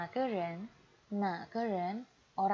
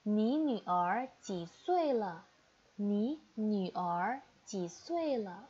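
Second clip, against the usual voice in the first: slow model reading; 3 dB louder, much slower than usual. Shown as a demonstration. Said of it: "teacher models Mandarin phrase".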